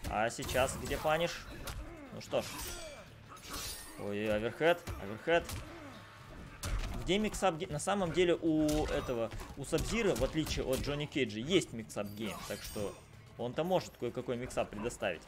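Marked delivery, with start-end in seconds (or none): none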